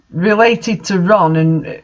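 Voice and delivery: ordinary delivery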